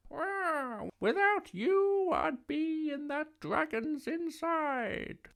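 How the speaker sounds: very high, drawn-out witch voice; high storybook witch full of relief; grateful witch. A narrator's reading. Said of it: "the grateful witch cried".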